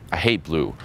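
falling, understated mock disapproval; acted matter-of-fact put-down; deadpan contrarian reply. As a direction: contradict her coolly. His contrarian reply in example.

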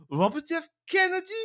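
hammed-up incredulous squeak; mock disbelief. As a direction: scoff at Robert F. Kennedy in high disbelief.

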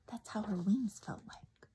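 soft unvoiced whisper; whispered aside. A narrator's reading. Teacher whispering aside to the listening child. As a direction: lean in and whisper it.

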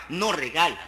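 usual speaking voice

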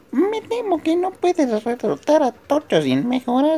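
high falsetto shopkeeper lady; man playing a chirpy woman, high up; woman's voice. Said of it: "¿Voy a ser voz de mujer?".

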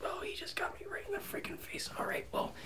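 shocked whisper. Real, not acted. Just spat in face, stunned whisper.

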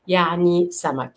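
usual speaking voice

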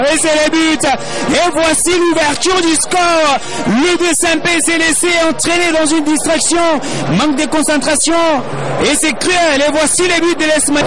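excited sports commentary. Real, not acted. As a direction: call the goal with rising excitement. Commentator calling a goal. (commentaire sportif enthousiaste, voix haute)

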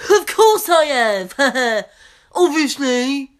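loud, slow, laughing smug put-on voice; mock the customer's know-it-all laugh. Waitress imitating a smug Nando's customer, loud laughing mockery. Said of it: "He just laughs and goes".

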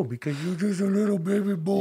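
put-on baby talk. Coos at dog.

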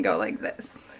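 soft, voiceless whisper. Real, not acted. Telling viewers she can't go out looking like this, whispered. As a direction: breathe it as a secret aside. Aside about her messy look.

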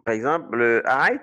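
ordinary delivery

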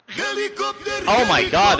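a high, slow, drawn-out oh my god; startled. Real, not acted. Streamer startled by a loud sound alert, high. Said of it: "that was fucking loud".

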